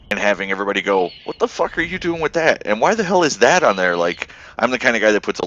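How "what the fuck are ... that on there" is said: high incredulous mock yell; mock baffled outcry. Other players jeering at his tiny gun, mock disbelief. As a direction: play the confused onlookers, pitched way up.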